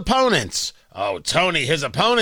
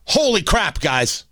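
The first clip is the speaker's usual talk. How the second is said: usual speaking voice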